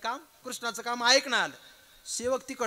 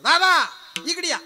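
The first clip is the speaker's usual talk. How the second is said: high, loud hail to an elder brother; loud calling out; 6 semitones higher, 9 dB louder than usual. Acted. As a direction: call him over from across a meeting. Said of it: "मोठ्यानं आवाज यायचा बलराम दादाला".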